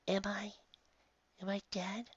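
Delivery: high, soft, awed question; soft whisper. Acted. Dying girl whispering to the bright light, high and soft. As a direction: breathe it in wonder. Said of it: "she whispered softly".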